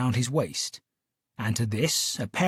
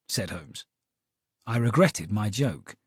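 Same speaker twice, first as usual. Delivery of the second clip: ordinary delivery, nothing marked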